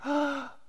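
high, astonished gasp; sharp high "Ah!" of mock amazement; imitated crowd gasp. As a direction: mimic onlookers gasping in shock. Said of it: "people are going".

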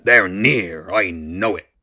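loud, menacing monster; booming voice. A narrator's reading. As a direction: boom out the threat. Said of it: "the voice boomed".

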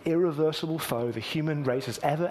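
usual speaking voice

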